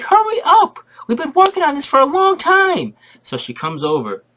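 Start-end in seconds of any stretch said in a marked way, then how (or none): 0.0-2.9 put-on squeaky high little kids calling Mommy; kid voices. Kids begging their mom to come see. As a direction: chirp it up high like excited little boys.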